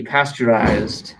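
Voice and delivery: pasteurized drawn out slowly after spelling; slow clear word. Shown as a demonstration. Teacher to class after spelling, slowly saying pasteurized. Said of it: "P A S T E U R I Z E D".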